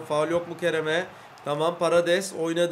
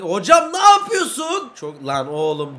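frustrated yell; 7 dB louder, slower than usual. Real, not acted. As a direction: yell at the player in disbelief. Gamer angry at his player.

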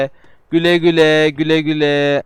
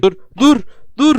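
high, louder stop calls; panicked stop; 10 semitones higher, 4 dB louder than usual. Real, not acted. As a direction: yell stop in alarm. Sliding away, urging stop.

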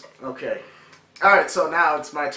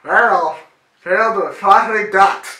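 mouth guard in; 6 semitones higher, 12 dB louder than usual. Real, not acted.

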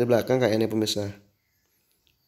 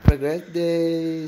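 outro sign-off; 7 semitones higher, 5 dB louder, slower than usual. Acted.

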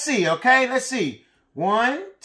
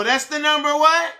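ordinary delivery

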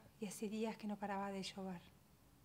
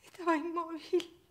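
loud, high, sobbing outcry; anguished breakdown; 9 semitones higher, 10 dB louder than usual. Acted. Mother who cannot look at her still baby, anguished breakdown. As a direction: cry it out in raw grief, pitched high.

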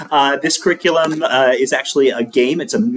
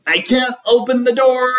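gasped; 10 semitones higher than usual. A narrator's reading. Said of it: "Lewis gasped".

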